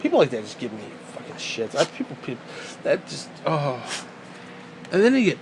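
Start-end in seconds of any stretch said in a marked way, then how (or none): none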